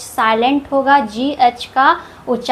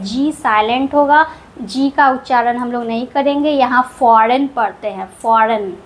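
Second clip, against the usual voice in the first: ordinary talk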